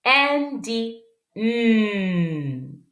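N dit N drawn out very slowly; slow letter sound. Shown as a demonstration. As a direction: stretch each N sound long. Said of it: "Ça, c'est la lettre N".

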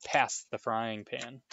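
slow, drawn-out rude order; rude demand. Acted. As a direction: make a lazy demand of Harry. Dudley rudely demanding the pan.

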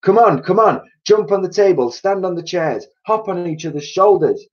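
high, urgent rallying cries; bossing everyone along in a frantic hurry; frenzied excitement. A narrator's reading. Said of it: "in a frenzy of excitement".

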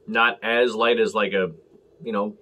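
normal talking voice

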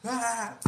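high, loud comic yell; hammed-up fall scream; mock falling yell. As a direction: shout as he drops. Character falling in retold clip.

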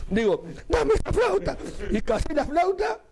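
high voice breaking with laughter; high giggly retelling; laughing while telling. Real, not acted. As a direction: tell it while cracking up. Laughing through the punchline.

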